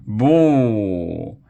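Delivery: long, drawn-out boo; crowd-style heckling boo. A narrator's reading. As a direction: jeer the act loudly. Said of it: "Everybody started booing".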